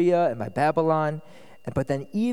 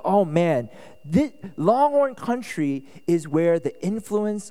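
louder, energetic sermon delivery; animated preaching; 2 semitones higher, 5 dB louder than usual. Acted. Pastor explaining Longhorn country to his congregation, animated. Lively preaching at raised volume.

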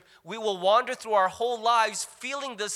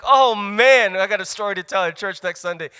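gleeful; 9 dB louder than usual. Retold or imitated. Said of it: "You're like".

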